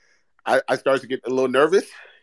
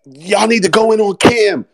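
loud retold demand; 7 semitones higher, 6 dB louder than usual. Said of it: "people who have been saying".

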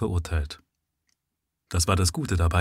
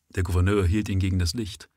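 ordinary delivery

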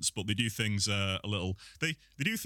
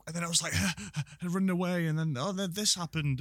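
high, hammed-up excited retelling; mock animated storytelling; 9 semitones higher than usual. Guest showing how he gestures while talking, high hammed-up retelling. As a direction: act out a silly action story.

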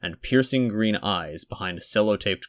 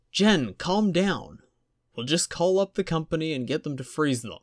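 lightly voiced calming partner; 8 semitones higher than usual. A narrator's reading. Alan calming his panicking partner.

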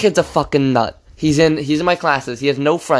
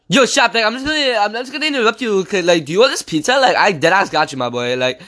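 Muzz. Retold or imitated. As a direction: imitate a lisping kid making an offer. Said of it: "He goes".

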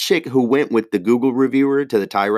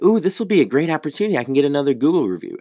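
mock eager; 5 semitones higher, faster than usual. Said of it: "the Google reviewer chick was like".

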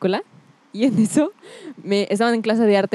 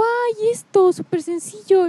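high-pitched imitated "so simple"; breezy teacher; 11 semitones higher, much slower than usual. Girl quotes teacher who understood at once, mock breezy. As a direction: mimic a teacher making light of it, pitched high.

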